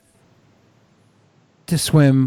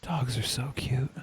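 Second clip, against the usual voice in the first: soft cooing; 15 dB quieter, slower than usual. Real, not acted. Cooing about dogs.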